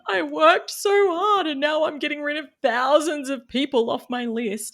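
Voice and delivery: mock anguished complaint; exasperated inner voice. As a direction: wail the lost effort in mock despair. Retold frustration culling email list.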